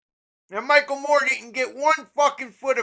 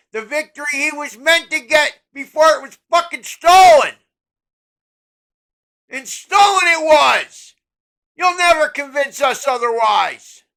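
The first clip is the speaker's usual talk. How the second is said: furious shout; 6 dB louder than usual. Real, not acted. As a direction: yell it like a final verdict. Ranting about a stolen election.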